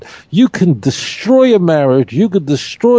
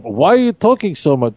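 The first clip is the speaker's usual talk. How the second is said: normal talking voice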